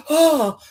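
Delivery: high, loud mock gasp; imitated gasp of alarm, pitched high; startled gasp. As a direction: act out waking with a shocked "ah". Acting a woman startled awake.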